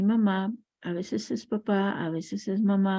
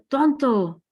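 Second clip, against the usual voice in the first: high, loud yell of an insult; mock shout; 5 semitones higher, 6 dB louder than usual. Father yells an insult while walking with his son, loud mock shout. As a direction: bark it out like an angry father. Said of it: "el papá grita".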